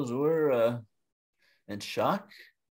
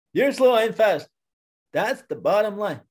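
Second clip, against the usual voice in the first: higher, louder insistence; exasperated bear; 7 semitones higher, 5 dB louder than usual. Acted. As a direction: lay down the final word forcefully. Bear insists, getting heated.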